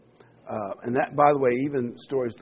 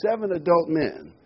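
ordinary talk

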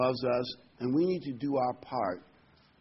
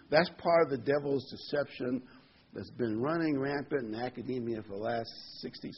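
usual speaking voice

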